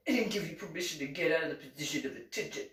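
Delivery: a hint of a sergeant, quick clipped orders; drill sergeant bark. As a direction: rattle off the reprimand fast like a drill instructor. Drill sergeant scolding recruit.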